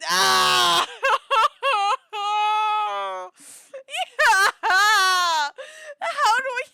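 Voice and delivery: high, loud laughing shrieks; shrieking laugh fit; screaming laughter. Real, not acted. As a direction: lose it completely laughing. Breaks down laughing at pronunciation.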